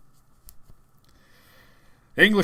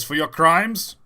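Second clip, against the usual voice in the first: raised, loud sentencing voice; whip-wielding official; 5 semitones higher, 8 dB louder, slower than usual. Retold or imitated. Man with a whip sentencing three prisoners, loud official voice. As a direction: pronounce sentence loudly, pitched up. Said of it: "There's a bloke there with a whip".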